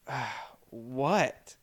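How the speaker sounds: groggy mumble. Retold or imitated. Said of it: "and I'm like".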